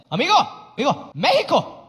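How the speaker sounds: lightly voiced broken-English Mexican accent; old Mexican man in broken English. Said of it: "this is what he said".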